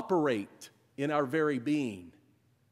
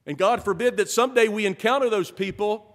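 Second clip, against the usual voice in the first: higher, louder and fast, building urgency; rally the church with rising fire; fervent preaching; 8 semitones higher, 9 dB louder than usual. Acted. Pastor urges the congregation toward the marginalized, fervent.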